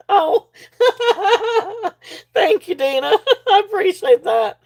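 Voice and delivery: real high-pitched giggling through the words; laughing. Real, not acted. Seller thanking a viewer for a comment, laughing.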